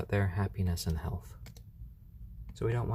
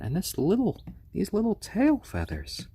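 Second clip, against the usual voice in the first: much higher, louder cooing; 1 cooing delight; 11 semitones higher, 4 dB louder than usual. Real, not acted. Owner showing off chick feathers, higher cooing delight. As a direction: gush over the tiny feathers.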